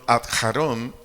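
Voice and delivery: adjarón with the final O accented; stress on the O. Shown as a demonstration. Spelling out a Hebrew word for the congregation, stressing the O in adjarón. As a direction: stress the last syllable of adjarón. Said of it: "acento en la O".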